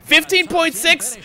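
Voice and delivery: high, loud, strained despair; exasperated rant. Real, not acted. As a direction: wail it, disbelieving. Despairing rant about pit stops.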